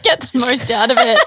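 cracking up mid-sentence; laughing. Real, not acted. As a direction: joke told through giggles. Joking about the paid session.

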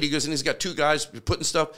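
ordinary talk

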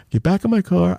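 ordinary delivery

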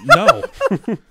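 loud, high laughing no. Retold or imitated. Refusing the helpers' offer of water, laughing.

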